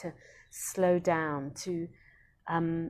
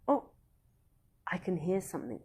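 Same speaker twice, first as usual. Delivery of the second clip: acted wondering emphasis. Shown as a demonstration. Teacher showing parents how to notice a sound, acted wonder. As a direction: draw a child's attention to a sound, gently acted. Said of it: "you're placing emphasis on something".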